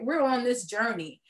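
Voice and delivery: ordinary talk, nothing marked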